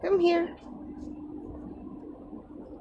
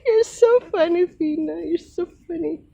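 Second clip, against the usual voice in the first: delighted pet talk; 5 semitones higher, 6 dB louder than usual. Real, not acted. Laughing at the cat.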